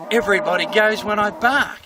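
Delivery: high, loud triumphant declaration; boastful dog. Acted. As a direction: proclaim it as an all-conquering dog. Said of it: "And the dog goes".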